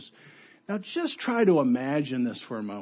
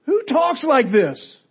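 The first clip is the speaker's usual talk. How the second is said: high, loud, slow incredulous question; outraged disbelief; 9 semitones higher, 6 dB louder, slower than usual. Acted. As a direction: demand it as an appalled crowd would. Acts the incredulous crowd.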